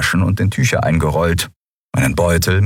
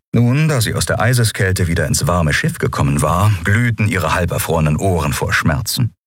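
usual speaking voice

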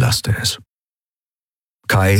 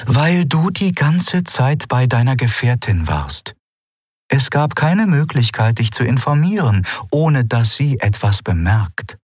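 Ailan justifying herself; 6 semitones higher than usual. A narrator's reading. Ailan defending her silence to Kai, deliberate.

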